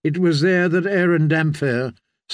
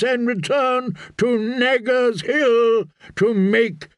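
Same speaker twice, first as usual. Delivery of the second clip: higher-pitched, slow proclamation; ringing declamation, building in pitch; prophet's summons; 6 semitones higher than usual. Acted. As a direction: summon a whole people with priestly authority.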